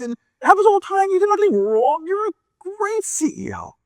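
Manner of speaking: high-pitched reassurance climbing to a peak; impassioned pep talk. Acted. Investor tells struggling CEO he did nothing wrong, impassioned.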